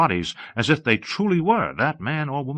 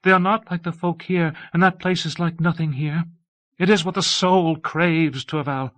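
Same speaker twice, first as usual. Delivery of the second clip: high, urgent pleading; begging; 6 semitones higher than usual. A narrator's reading. Abbess on her knees to Torval for three days, begging. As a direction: plead in a raised pitch without letting up. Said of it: "She begged him".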